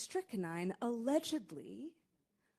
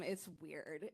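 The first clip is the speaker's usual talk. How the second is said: a quiet, lower murmur to yourself; half under the breath, echoing yourself; muttered aside; 7 semitones lower, 10 dB quieter than usual. Real, not acted. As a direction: drop it low and soft to yourself.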